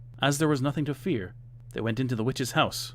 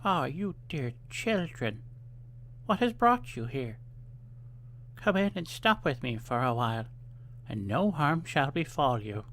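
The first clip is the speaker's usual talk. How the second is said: put-on sugary old woman voice; kindly old woman; 3 semitones higher than usual. A narrator's reading. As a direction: lure them in with fake kindness.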